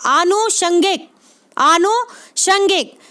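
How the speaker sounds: आनुषंगिक in two slow pieces, aanu, shangik; long initial vowel. Shown as a demonstration. Teacher repeating the right form for students, slow and split. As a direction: stretch the aa of आनुषंगिक, pause, then षंगिक. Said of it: "आ बड़ा आ आएगा".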